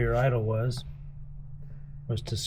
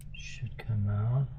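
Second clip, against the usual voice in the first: whisper; 7 dB quieter than usual. Real, not acted. Quiet work under breath.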